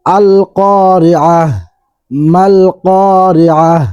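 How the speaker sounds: "আল কারিআহ" ending in a stopped h, slow; pausal form. Shown as a demonstration. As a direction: recite "আল কারিআহ" stopping on the final h. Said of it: "ওয়াকফ করলে". ("আল কারিআহ" থেমে হ দিয়ে শেষ)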